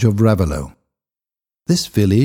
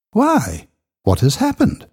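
sharp, higher surprised question; alarmed question; 11 semitones higher than usual. Acted. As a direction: ask it startled and worried. Accused man asks, alarmed.

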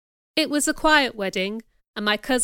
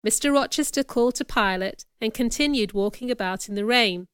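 plain reading voice

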